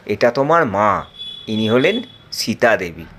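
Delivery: very low, solemn introduction; solemn god. Acted. Rama introducing Sita in a deep solemn voice. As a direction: announce the goddess gravely.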